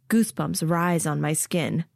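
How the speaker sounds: usual speaking voice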